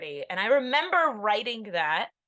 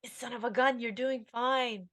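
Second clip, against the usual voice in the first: reassuring herself; 4 semitones higher than usual. Retold or imitated. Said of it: "and I'm going".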